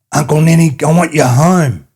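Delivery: put-on loud, high little girl's plea to come home; an excited young niece calling her uncle home, high and loud. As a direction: call out to him, high and loud, begging.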